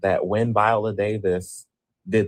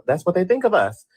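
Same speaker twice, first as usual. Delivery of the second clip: exasperated outburst; 10 semitones higher than usual. Real, not acted. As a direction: throw it out in disbelief. Bitter rant about how actors are treated.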